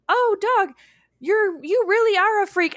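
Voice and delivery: high, excited realization; Tendi realizing. Retold or imitated. Tendi calls after the floating dog, high and amazed. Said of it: "so she goes".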